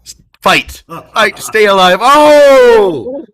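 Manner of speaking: high, loud desperate cheering; frantic shout. Acted. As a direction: yell at Sam to hang on. Host yells to cohost.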